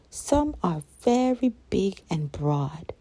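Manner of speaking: ordinary delivery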